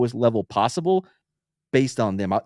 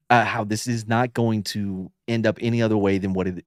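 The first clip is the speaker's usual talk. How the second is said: normal talking voice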